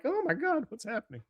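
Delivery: high-pitched, hushed panic; mock alarm. Frightened family as the gate shakes, high mock panic.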